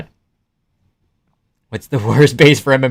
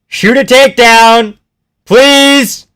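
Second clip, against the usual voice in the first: higher, loud, begging yell; pleading shout; 10 semitones higher, 7 dB louder, slower than usual. Real, not acted. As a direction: beg the fighter to shoot in. Yelling instructions at the fighter.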